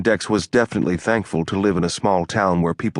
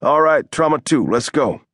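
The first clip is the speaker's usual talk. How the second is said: higher-pitched rallying call; calling orders; 7 semitones higher, 4 dB louder than usual. A narrator's reading. Hunter sending the team to Trauma Two, calling out.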